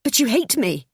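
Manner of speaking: high, loud blurted out; wife blurting out her hurt, high and loud. A narrator's reading. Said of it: "the words tumbling from my mouth".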